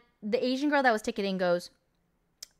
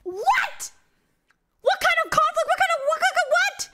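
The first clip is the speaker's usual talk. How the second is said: much higher, squeaky incredulous what; shocked disbelief; 3 dB louder than usual. Real, not acted. Reviewer reacting to the absurd movie scene, shrieking in disbelief. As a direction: gasp it out, utterly baffled.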